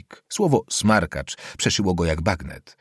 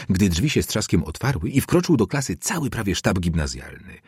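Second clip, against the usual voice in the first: usual speaking voice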